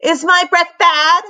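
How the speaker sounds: high loud demand; angry demand, pitched high. A narrator's reading. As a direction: insist on an answer. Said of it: "she demanded".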